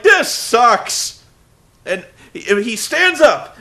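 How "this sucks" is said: loud, slightly raised yell as Cole; mock yell. Cole losing it on commentary, a mock yell. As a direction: complain like a fed-up commentator. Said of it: "he's like".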